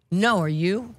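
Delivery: a laughing, cheeky comeback. Retold or imitated. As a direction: snap it back with a laugh. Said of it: "And I went".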